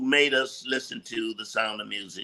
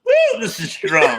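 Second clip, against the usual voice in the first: mock drunk reaction; 8 dB louder, slower than usual. Pretend sipping Cosby wine.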